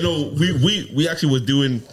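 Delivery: ordinary delivery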